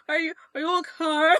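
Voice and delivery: high, dragged-out "okay" in mock Russian; drawn-out mock accent. Teasing the co-host's bad accent, words drawn out. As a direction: imitate the accent, stretching "okay". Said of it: "like, drug out".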